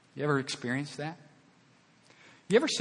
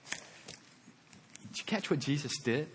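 ordinary talk

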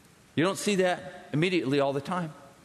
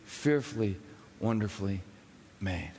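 reverent hush; 10 semitones lower, 5 dB quieter than usual. Acted. Preacher recalls the verse at his son's birth, hushed and fading. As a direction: lower and softer, fading to almost nothing.

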